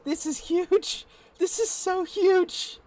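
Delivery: high, drawn-out exclamation of awe; overwhelmed amazement. Real, not acted. Builder seeing four hours of work, high-pitched awe. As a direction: marvel in a slow, high-pitched squeal.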